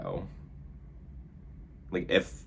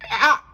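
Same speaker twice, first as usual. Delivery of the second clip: sudden high scream of fright; startled shriek; 11 dB louder than usual. Real, not acted. A quick shriek after saying something creepy. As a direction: yelp at the creepy moment. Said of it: "That was very creepy".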